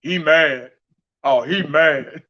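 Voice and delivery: slow, low mock quote; mocking commenter. Vlogger quoting an ignorant commenter, mocking. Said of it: "that was an ignorant comment".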